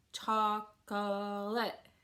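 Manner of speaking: "chocolate" very slow, choc-o-late; three drawn-out chin-drop beats on "chocolate"; syllable by syllable. Shown as a demonstration. Teacher showing the chin drop tip, "chocolate" split into syllables. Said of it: "you have a syllable".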